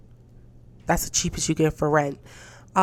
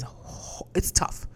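whispering; 5 dB quieter, much slower than usual. Real, not acted. Whispered self-correction.

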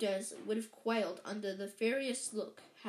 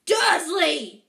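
huge, higher-pitched shout; booming; 6 semitones higher, 16 dB louder than usual. A narrator's reading. Giant about to explode at the uncle, booming. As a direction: boom out the surname in fury. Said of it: "he boomed".

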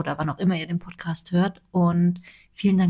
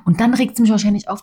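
ordinary delivery

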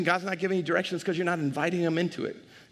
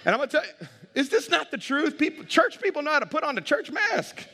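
raised, laughing preacher push; preaching at full force; 7 semitones higher than usual. Acted. Pastor urging the church to drop the mask, preaching hard. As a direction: preach it up high and strong.

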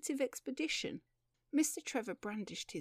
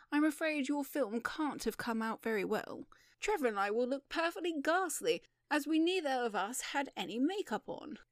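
higher, emphatic objection; raised, insistent voice; cried protest; 5 semitones higher, 4 dB louder than usual. Acted. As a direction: protest with animated dismay. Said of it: "cried Beau Maturin".

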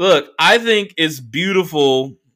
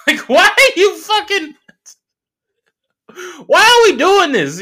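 laughing disbelief; 4 dB louder than usual. Retold or imitated. Mocking the DJs' song choice.

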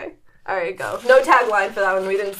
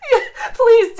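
laughing; 10 semitones higher, 5 dB louder than usual. Real, not acted. Cracking up at dolphin impression.

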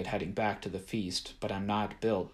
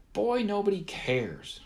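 high, slow, drawn-out shout; 12 semitones higher than usual. A narrator's reading. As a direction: call it out dismissively. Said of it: "Gumbaby shouted".

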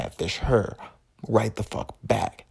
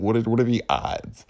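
normal talking voice

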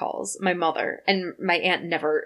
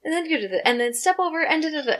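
high, sing-out instructor calls; imitating a class leader's high-pitched cueing; step class instructor; 7 semitones higher, faster than usual. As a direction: call out step moves like an instructor. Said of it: "Yeah, she's like".

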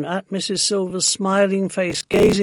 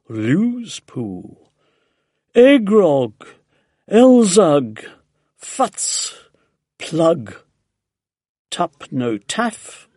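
slow, measured chanting of nonsense words; incantation; 6 dB louder, much slower than usual. A narrator's reading. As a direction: intone each strange phrase deliberately, with pauses. Nonsense spell read from the paper.

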